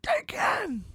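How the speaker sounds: high-pitched called-out name; a drunk fan's high, strained call; mock yell. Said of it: "So I just kinda yell".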